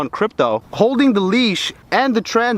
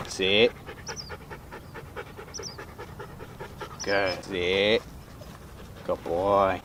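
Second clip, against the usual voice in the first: talking to a dog; 11 semitones lower, 13 dB quieter, much slower than usual. Real, not acted. Commands and praise to dog.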